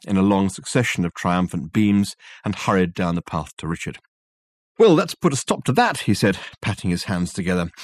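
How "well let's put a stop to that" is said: higher, brisk and satisfied; pleased Dirk. Acted. Dirk pleased with himself, speaking to Richard. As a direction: brush off the matter cheerfully.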